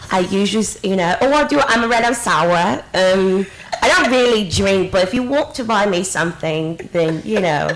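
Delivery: put-on British bar patter; British accent. Shown as a demonstration. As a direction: fake British accent with Cockney leanings. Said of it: "This is the accent".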